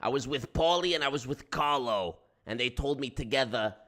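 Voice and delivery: lightly held Brooklyn wiseguy; a hint of mobster recalling friends; New York accent.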